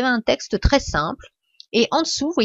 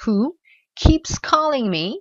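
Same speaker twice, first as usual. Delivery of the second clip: slow, higher "who keeps calling me"; slow model phrase; 4 semitones higher, slower than usual. Shown as a demonstration. Teacher shows the silent W and G. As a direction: say it slowly, no G at the end. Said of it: "alors comme ça".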